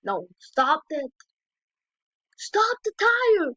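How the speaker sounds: high, slow drawn-out plea to the screen; pleading yell. Real, not acted. Watching a tire roll, begs the screen, high drawn-out yell. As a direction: stretch it out high like begging the clip to stop. Yelling at video about tire.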